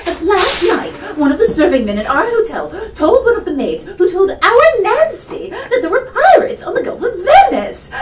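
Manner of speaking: high, loud, breathless fussing matron; a flustered mother spilling gossip with a laugh; fretful mother. Acted. Mother frets to her daughter and Jane about pirates, high and flustered.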